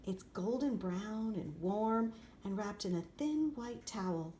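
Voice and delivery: ordinary delivery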